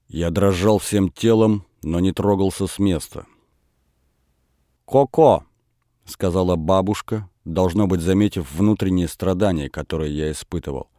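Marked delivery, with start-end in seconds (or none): 4.8-5.4 a raised, commanding call of the name. A narrator's reading. Grandmother calls the stubborn grandson, commanding. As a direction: summon the boy sharply, high. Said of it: "не столько повелительным".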